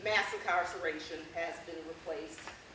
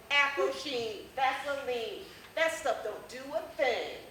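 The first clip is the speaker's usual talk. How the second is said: loud, high rhythmic taunt; performed comic rhyme; 6 semitones higher, 6 dB louder, slower than usual. Acted. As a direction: tease in rhyme, drawing out the calls. Performs rhymed opening of essay.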